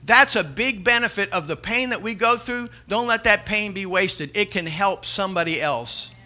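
loud, driving preacher's call; full-force preaching. Acted. Pastor urging the congregation to help others, loud preaching.

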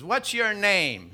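slow, high, loud acted question. Burglar questioning a talking parrot, loud and high. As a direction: call the question out slowly.

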